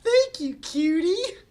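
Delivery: put-on very high, bright girly voice; squeaky cheerful sister; Bubbles voice. Bubbles voiced high in table read.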